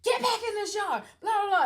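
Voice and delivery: high, loud yell from the doorway; mock mom yell. Mother shouting at her daughter walking off down the yard, loud. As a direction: holler after a child walking away.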